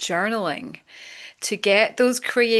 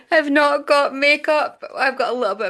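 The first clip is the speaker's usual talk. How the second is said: high, laughing admission; real laughing; 7 semitones higher, 6 dB louder than usual. Real, not acted. Host confessing her unbrushed look to friends, laughing. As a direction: burst out laughing and carry it into the words.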